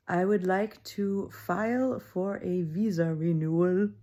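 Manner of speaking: put-on slow, careful German accent; a light German accent, said slowly and carefully; lightly German-accented visa request. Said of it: "That's with a German accent".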